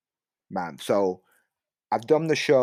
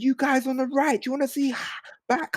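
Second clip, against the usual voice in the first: hyping the crowd. Retold or imitated. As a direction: call out to rev up the crowd.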